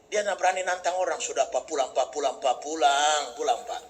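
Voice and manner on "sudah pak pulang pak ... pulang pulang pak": low, soft pleading to go home; pleading wife. Retold or imitated. Wife begging her husband to come home, low and soft. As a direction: coax him gently home.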